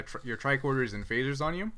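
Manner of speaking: normal talking voice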